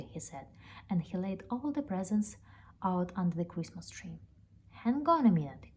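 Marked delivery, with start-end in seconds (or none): 4.7-5.7 louder, higher call to stop. Acted. Man calling out to stop the bear, louder. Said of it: "cried the man".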